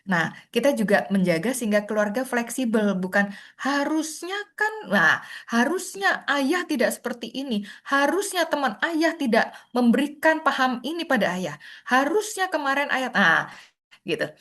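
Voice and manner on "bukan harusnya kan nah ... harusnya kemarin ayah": voiced higher, insisting on how it should have been; rigid blaming tone. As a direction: mimic someone stuck on "should have". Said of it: "Itu tidak fleksibel". (nada menyalahkan yang kaku)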